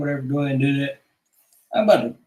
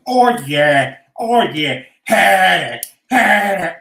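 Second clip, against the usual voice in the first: much louder yelled oh yeah; loud mock ecstasy; 9 dB louder, slower than usual. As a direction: whoop it out. Voiceover loud exclamations.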